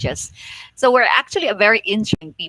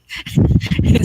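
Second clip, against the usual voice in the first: laugh; 4 dB louder than usual. Real, not acted. Laughs at her own imitation.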